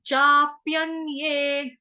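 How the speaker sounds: चाप्यन्ये with long चा, slow; slow chaapyanye. Shown as a demonstration. Teacher drills long vowel in चाप्यन्ये slowly. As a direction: stretch the चा in चाप्यन्ये. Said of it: "दीर्घ मात्रा है".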